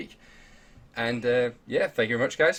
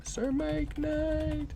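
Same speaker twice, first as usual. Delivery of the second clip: grand announcer; 6 dB quieter, much slower than usual. Pretend.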